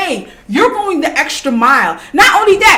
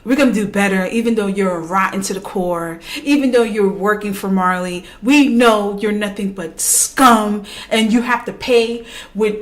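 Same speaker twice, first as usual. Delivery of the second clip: lightly voiced smug lecturer, low and hushed; mock villain speech; 6 semitones lower, 4 dB quieter than usual. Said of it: "He literally sat there and said".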